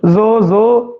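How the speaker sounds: ਜ਼ੋ with a buzzing z, repeated; sound demo. Shown as a demonstration. Teacher demonstrating ਜ਼ੋ for students. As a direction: buzz the ਜ਼ in ਜ਼ੋ. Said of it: "ਜ਼ੋ ਦਾ ਜਦੋਂ ਆਪਾਂ ਉਚਾਰਨ ਕਰੀਏ".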